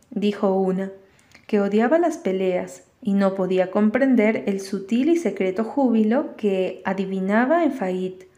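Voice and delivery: ordinary delivery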